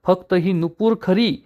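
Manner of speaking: ordinary delivery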